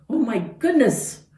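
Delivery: ordinary delivery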